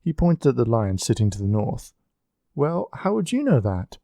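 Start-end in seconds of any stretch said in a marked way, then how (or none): 2.5-4.0 high, incredulous question; stunned disbelief. A narrator's reading. Margaret questions Marco, stunned and incredulous. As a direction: she can't believe he knows it. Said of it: "Margaret looked at him stunned".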